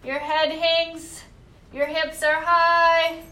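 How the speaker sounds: slow, steady class cueing; yoga instruction. Acted. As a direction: lead the class through each pose. Teacher cues downward dog.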